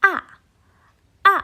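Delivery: short, sharply falling "A"; abrupt top-to-bottom fall on "a"; fourth tone. Shown as a demonstration. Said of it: "nada empat itu turun".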